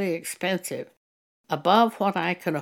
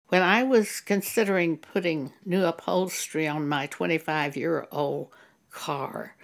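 usual speaking voice

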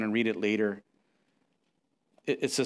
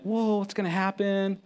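startled; 7 semitones higher than usual. Retold or imitated. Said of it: "I'm like".

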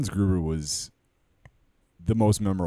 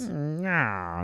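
mustache twirling villain; 9 semitones higher than usual. Acted. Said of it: "I'm twirling my mustache".

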